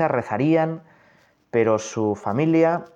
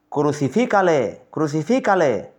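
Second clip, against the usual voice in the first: crowd shouting; 4 semitones higher, 4 dB louder than usual. Acted. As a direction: call it out as the angry mob. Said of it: "volvieron a dar voces".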